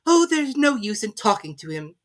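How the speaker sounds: put-on high girl, a touch of despair; giving up on him; mildly desperate Alice. A narrator's reading. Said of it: "said Alice desperately".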